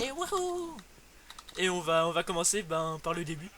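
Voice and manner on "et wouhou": high gleeful whoop; excited cheer. Real, not acted. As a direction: whoop it out with glee. Cheering at start of game.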